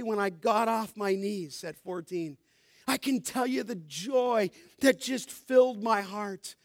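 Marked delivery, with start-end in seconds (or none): none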